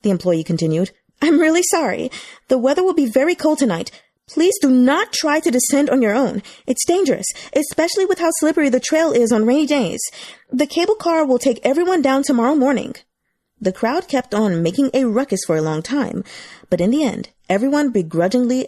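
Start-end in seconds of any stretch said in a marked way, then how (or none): 1.2-13.0 higher, raised pleading voice; apologetic announcement. A narrator's reading. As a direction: soothe a restless crowd. Staff member addresses crowd.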